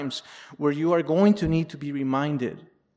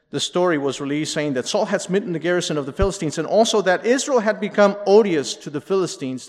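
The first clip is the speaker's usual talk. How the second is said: ordinary delivery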